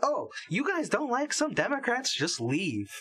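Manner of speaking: high-pitched mock scolding, dropping at the end; exaggerated, singsong dismissive quote; mocking Michelle Obama. Podcaster parodying Michelle's advice to critics, mocking.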